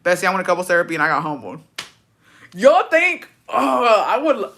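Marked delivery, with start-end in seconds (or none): 2.5-4.6 high, loud cackle breaking into the words; cracking up, too tickled to finish; laughing. Real, not acted. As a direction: burst out laughing mid-sentence. Cackling at the therapy idea.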